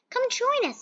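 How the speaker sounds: bright high call to join; cheerful invite. Acted. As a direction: invite her in, high and eager. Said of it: "said Millie".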